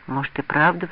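plain reading voice

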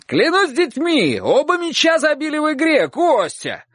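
high-pitched, elated oath; rapturous shout. A narrator's reading. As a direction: swear joyfully from the rooftop. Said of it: "восторженно крикнул мужчина с крыши". (восторженный крик с крыши)

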